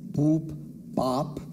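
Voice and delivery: usual speaking voice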